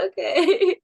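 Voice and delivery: small tearful okay, pitched high; mock tearful. Trainee hugged by her mentor, mock-tearful acceptance. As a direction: imitate answering through tears. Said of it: "Don't cry".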